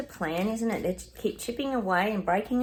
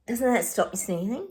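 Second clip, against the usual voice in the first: ordinary talk, nothing marked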